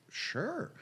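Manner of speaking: high-pitched, rising sure; small, uncertain yes; tentative agreement. Retold or imitated. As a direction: agree lightly on a high note. Retold answer to teaching offer.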